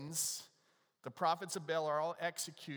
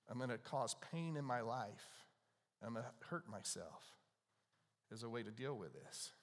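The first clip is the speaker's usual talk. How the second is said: low, hushed and heavy, softer; hushed grim voicing; 4 semitones lower, 6 dB quieter than usual. Preacher voicing prophets who hurt themselves, hushed. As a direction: lay the words down quietly like a grim confession. Prophets of Baal voiced in story.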